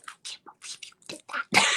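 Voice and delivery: mom's hissed whisper, imitated; scolding whisper. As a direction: whisper it like an appalled wife telling off her husband. Mom scolding dad, retold.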